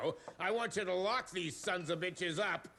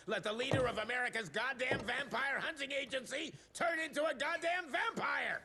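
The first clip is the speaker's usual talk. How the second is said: angry rant; 7 semitones higher than usual. Acted. Secretary berating the team.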